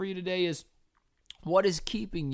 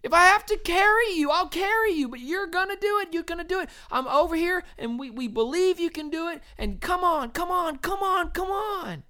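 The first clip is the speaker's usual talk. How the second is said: much higher, louder encouraging cheer; 12 semitones higher, 3 dB louder than usual. Retold or imitated. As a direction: cheer a runner over the line. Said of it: "you'd cheer them on".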